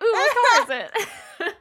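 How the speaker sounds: high, loud, drawn-out ooh; slow, high-pitched eager squeal; imagined car-ad excitement. Retold or imitated. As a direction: gush over it, laughing. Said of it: "and I was like".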